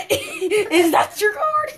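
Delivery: real laughter, slow and high; laughing. Real, not acted. Laughing through the trick.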